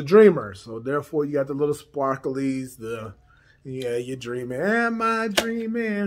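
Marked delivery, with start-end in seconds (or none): 4.6-6.1 dreamy voice. Pretend. Playful aside about the Dreamer name.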